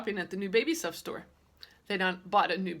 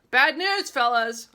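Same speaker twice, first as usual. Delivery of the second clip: loud high holler across the house; 6 semitones higher, 11 dB louder, slower than usual. Acted. Girl hollering to her parents from the living room, loud. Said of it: "I hollered very loud".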